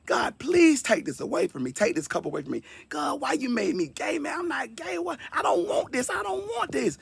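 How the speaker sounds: high, strained begging that breaks into a cry; desperate pleading prayer. Retold or imitated. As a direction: beg God to take it away, near tears. Retold prayer to God, anguished.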